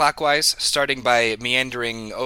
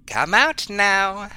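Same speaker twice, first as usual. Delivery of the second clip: slow, drawn-out, higher call; 9 semitones higher, 4 dB louder, slower than usual. Acted. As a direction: coax it out with a long, raised call. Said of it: "Gwillem will call out".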